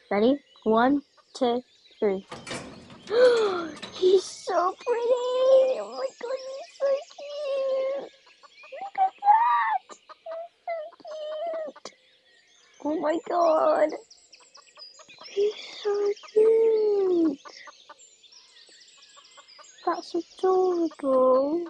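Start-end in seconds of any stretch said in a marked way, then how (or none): 3.1-3.9 sharp gasp of delight, much higher; excited gasp. Real, not acted. First sight of the horse. 3.9-12.0 very high, slow squeal of delight. Real, not acted. First sight of the new horse. 12.9-21.7 excited gushing. Real, not acted. Still gushing over the horse.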